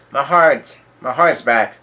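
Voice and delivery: a touch of elation; joyful. Retold or imitated. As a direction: exclaim it with retold joy. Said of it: "full of joy and happiness".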